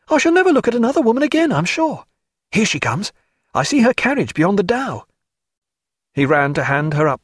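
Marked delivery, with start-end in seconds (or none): 0.1-5.1 high, fast and breathless with infatuation; swooning over a lady, pitched up and rushed; lovestruck gushing. Acted. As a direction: gush like a smitten young officer. Smitten officer gushing over Mrs Villiers.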